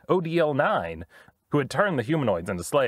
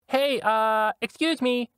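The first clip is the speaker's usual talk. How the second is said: high droid voice; 9 semitones higher, much slower than usual. Acted. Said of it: "L P asked".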